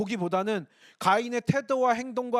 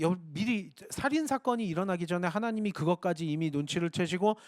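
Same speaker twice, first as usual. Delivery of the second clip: ordinary talk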